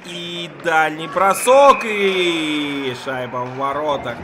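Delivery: rising to a loud, slow goal shout; excited hockey goal call. Real, not acted. Commentator calls a goal.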